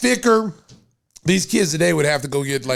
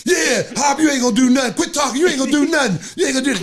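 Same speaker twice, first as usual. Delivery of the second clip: trash talk; 7 semitones higher, faster than usual. Retold or imitated. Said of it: "talking trash".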